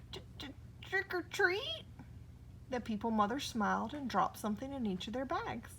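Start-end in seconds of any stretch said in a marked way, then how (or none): 0.1-1.8 high nervous stammer on the t. A narrator's reading. Hugly facing the People Mother, high frightened stammer. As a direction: stutter it out, scared. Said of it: "he stammered".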